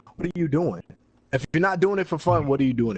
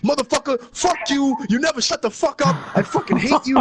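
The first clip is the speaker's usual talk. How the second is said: much higher, mock furious shriek of a raging player; imitating angry rant; 10 semitones higher than usual. Imitating an angry player cursing him out over a comment, shrill high mock rage. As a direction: squeal it like a hothead losing it. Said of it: "And he's like".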